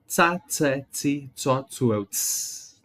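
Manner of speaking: ts syllables hissed like a soda can opening; fizzy ts sound. Shown as a demonstration. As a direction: hiss Tsa, tse, tsi, tso, tsu like opening a soda. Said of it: "como se eu estivesse abrindo um refrigerante".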